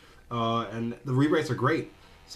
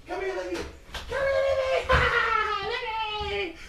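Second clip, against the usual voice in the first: very high, squealed pet call; coaxing the dog; slower than usual. Real, not acted. Owner fetching Libby to show viewers, high-pitched coaxing.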